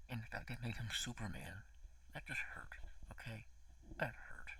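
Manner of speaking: whispered, wounded and half joking; hurt whisper. Real, not acted. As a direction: whisper it like it stings. Hurt by the ET cameo.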